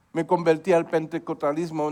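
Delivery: ordinary talk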